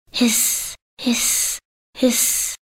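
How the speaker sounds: soft unvoiced snake hissing. A narrator's reading. Narrator hissing the snake's sound to listeners. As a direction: hiss softly like a snake.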